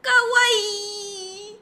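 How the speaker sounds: high, cutesy cheer; imitating cheering girls. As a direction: squeal it like an excited fan. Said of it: "y empiezan". (imitando a fans japonesas, agudo)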